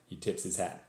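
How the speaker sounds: put-on story read in a Texan accent; attempted Texas accent. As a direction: narrate as a Texan, imitated accent. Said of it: "I'm going to attempt a Texas accent".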